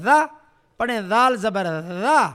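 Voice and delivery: ذا with tongue tip on the upper teeth; lisped dhal. Shown as a demonstration. Teacher drilling the letter dhal, lisped ذا. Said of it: "زبان کی نوک، اوپر والے دانتوں کی نوک".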